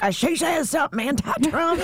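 high, laughing Southern caricature; mock Southern accent. Imitating people reacting to the halftime show, high mock Southern accent. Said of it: "give him a Southern accent".

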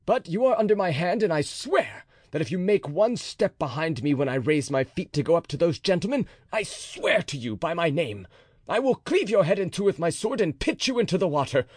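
captain swearing to kill the officer; furious oath. A narrator's reading. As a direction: swear it with mounting rage. D'Artagnan's sworn threat.

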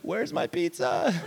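high, laughing through the quote; laughing kid. Retold or imitated. Dazed kid asking the driver for his pizza, laughing. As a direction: crack up as you ask about the pizza. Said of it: "I'm just like".

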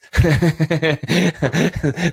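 real burst of high laughter; amused laughing fit at the phone. Real, not acted. Laughing at Siri mix-up.

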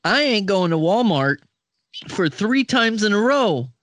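high, drawn-out sassy voice; mock sassy daughter refusing an errand; sassy refusal. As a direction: sass back at mom, slowly. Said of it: "I would be like".

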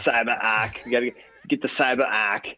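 hammed-up Boston accent. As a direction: drop the r's, Boston style. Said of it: "use your Boston accent to say CyberArk".

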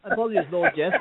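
high, fawning posh butler; clipped wartime posh. Pretend. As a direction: grovel like a posh servant. Servile apology to "Lord Jeff.

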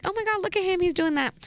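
high-pitched impressed gush; retold pleasant shock, pitched well up; shocked and proud. Girlfriend shocked by his clean Explore page, proudly surprised. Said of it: "and kind of proud".

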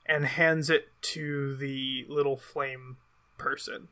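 ordinary talk

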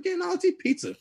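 high, airy mock Omni-Man; careless villain voice. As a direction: shrug it off in a high voice. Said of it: "He's like".

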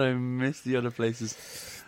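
drawn-out wistful sigh of a line; mock wistful. Friend mocking the customer, slow soft lament. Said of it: "he goes".

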